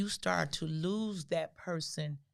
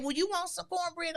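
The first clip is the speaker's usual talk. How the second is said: high, lightly scolding mother; voicing her mother; 12 semitones higher than usual. Daughter imitating her mother's high-pitched disbelief about cornbread. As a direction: ask it high and pointed, like a disbelieving mom.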